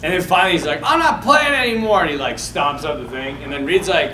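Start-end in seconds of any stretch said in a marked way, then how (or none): 0.8-2.0 higher, louder mock child's protest; mad kid. Telling of a boy quitting kickball, mock mad. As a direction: imitate a kid angrily quitting. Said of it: "he gets even more mad".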